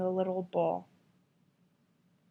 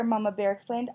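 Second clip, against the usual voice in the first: ordinary delivery, nothing marked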